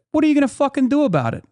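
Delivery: high, fast retort; confrontational challenge. Retold or imitated. Turning round on the man filming him, confrontational challenge. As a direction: square up to him, pitched well up.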